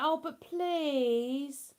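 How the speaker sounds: mock whine with a long drawn-out please; imitated pleading child. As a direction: beg like a pestering kid, dragging out the vowel. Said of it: "They're not like".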